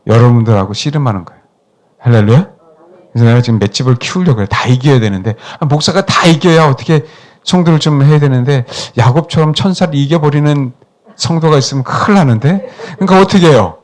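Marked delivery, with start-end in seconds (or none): none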